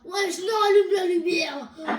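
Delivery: loud, wailing plea; panicked yell. Pretend. Player wants the light back on, loud panicky wail. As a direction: cry out in mock panic.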